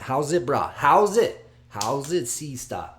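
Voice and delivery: loud, high, hammed-up Hawaiian greeting; horrible Hawaiian accent. Fight fan trying island greetings, bad Hawaiian accent. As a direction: call out in a badly faked Hawaiian accent. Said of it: "That's a horrible Hawaiian accent".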